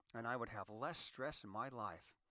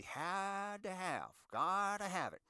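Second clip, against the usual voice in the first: mock craving; 7 semitones higher, 6 dB louder, slower than usual. Craving for needless Amazon buys.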